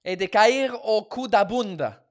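loud, emphatic attempt at Brazilian Portuguese; learner's hammed-up Brazilian Portuguese; imitated Brazilian Portuguese accent. Shown as a demonstration. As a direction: put on a bold Brazilian accent. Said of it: "I can almost imitate a Portuguese Brazilian accent".

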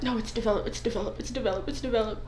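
quiet repeated correction; muttered to self. Retold or imitated. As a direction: drill it softly under the breath. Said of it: "in my mind, I'm like".

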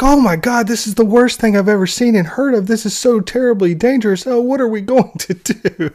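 high-pitched mock alarm; hammed-up panicked fretting. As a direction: overact helpless dread, pitched well up. Mocks a panicked overreaction to danger.

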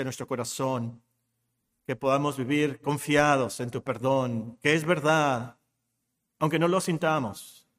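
low, calm prayer voice; closing prayer. Real, not acted. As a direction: pray reverently to God the Father.

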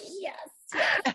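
high-pitched, triumphant double yes; gleeful yes. Retold or imitated. Author replying when her editor threatens to charge for puffs, gleeful. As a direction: crow it way up high.

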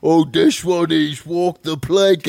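put-on high, loud, slow silly accent voice; mock accent. Co-host mocking the plank accent, hammed-up accent.

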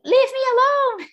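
high, loud outburst in retelling; exasperated yell. Retold or imitated. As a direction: yell it at a partner who won't help. Yelling at partner during labor, retold.

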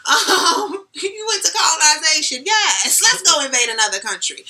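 high-pitched, teasing mock approval; mock impressed. Podcaster mockingly cheering on British colonization, sarcastic.

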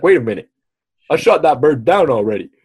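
badly faked German accent, in disbelief. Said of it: "that's not a German accent".

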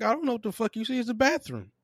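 high-pitched retold protest; exasperated retort. Retold baffled retort to manager.

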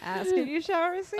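alien voice. Pretend. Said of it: "I wish I could laugh in that voice".

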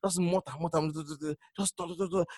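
soft fading mutter. Shown as a demonstration. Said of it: "you start to just mutter".

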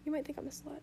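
plain reading voice

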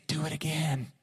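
put-on breathy little-girl whisper; whispered toddler plea. Little girl whispers to daddy to toss her again.

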